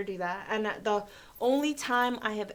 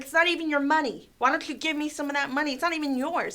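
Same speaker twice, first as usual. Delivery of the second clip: higher, indignant retold scolding; mock berating, pitched up and pushed; mimicked nastiness; 6 semitones higher, 5 dB louder than usual. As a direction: imitate a nasty customer berating the dealer. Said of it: "he got really nasty".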